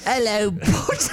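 high, drawn-out mock British accent. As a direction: echo a British film line, hammed up. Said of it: "our only British knowledge is Harry Potter".

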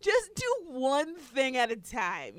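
retold wife telling husband to slow down; retold scolding. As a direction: call his name then slow down the advice. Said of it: "And I'm like".

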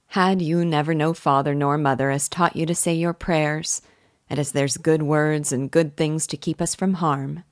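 ordinary delivery

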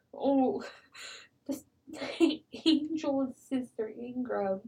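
really choking up, words broken by breaths; soft, halting, fighting back tears; misty-eyed. Real, not acted. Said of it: "actually getting a little misty-eyed".

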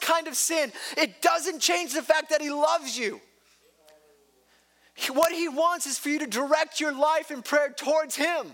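high, loud pulpit fervor; full-force sermon climax; impassioned preaching. Acted. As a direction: preach it out, rising with conviction.

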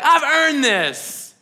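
hammered-out claim of what is owed; indignant entitlement. Acted. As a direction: act out a grievance, each word slow. Acted entitled brother's protest.

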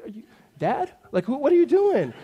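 high-pitched incredulous child imitation; bewildered little kids. Little boys baffled by dad's outburst, high and incredulous. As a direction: mimic kids questioning their dad, pitch leaping up. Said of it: "they looked at me kinda like".

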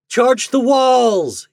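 long, high, loud battle cry; shouted order. A narrator's reading. Captain launching the attack on the fort, shouted order. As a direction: stretch out the charge command, very high. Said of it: "he ordered".

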